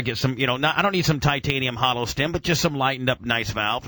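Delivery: normal talking voice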